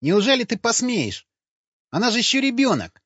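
high-pitched, disbelieving appeal; outraged protest. A narrator's reading. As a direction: protest sharply, pitch right up. (возмущённо, высоким голосом)

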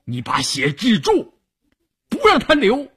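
high, urgent command, climbing; forceful order. A narrator's reading. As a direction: demand the impossible from the doctor. Officer orders doctor to stop bleeding. (厉声命令)

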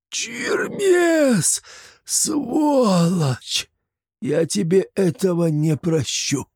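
muffled shout. A narrator's reading. Vampire shouts from the cellar at Chermes, muffled shout. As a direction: yell up from the pit in pain. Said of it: "раздался приглушённый крик из подвала".

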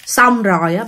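plain reading voice

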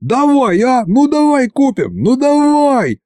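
put-on high drawn-out childish pleading; boy nags grandpa to buy them; whiny begging. A narrator's reading. High slow wheedling.

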